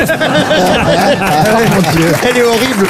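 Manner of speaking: mock horror at the dark joke; shocked laugh. Real, not acted. Réaction à la blague noire. (stupeur amusée)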